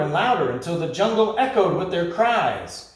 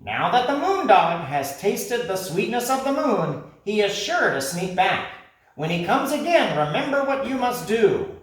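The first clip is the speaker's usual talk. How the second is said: high, firm warning; warning parrot; 6 semitones higher, 3 dB louder than usual. Acted. Parrot reminding the creatures what to do. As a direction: warn them seriously.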